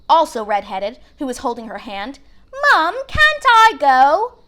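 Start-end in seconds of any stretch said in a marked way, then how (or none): 2.7-4.3 put-on pleading little girl. A narrator's reading. Little girl begs her mother.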